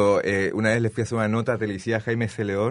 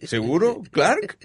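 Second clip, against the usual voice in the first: rising, much higher quizzical voice; mock disbelieving passerby; 8 semitones higher, much slower than usual. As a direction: ask it high and doubtful. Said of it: "Yo paro así".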